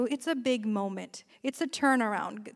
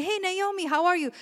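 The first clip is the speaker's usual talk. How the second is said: cheery greeting; 7 semitones higher, faster than usual. Retold or imitated. Women greeting Naomi cheerfully.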